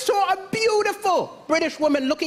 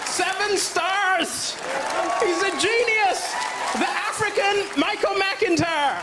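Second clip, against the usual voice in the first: slow, grandly proclaimed praise; hammed-up headline, every word stretched out; mock rave review; 3 semitones higher, slower than usual. Reads out his bought rave review.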